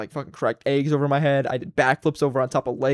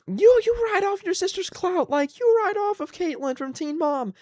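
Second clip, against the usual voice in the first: mocking Tina; slower than usual. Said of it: "she was, like, always like".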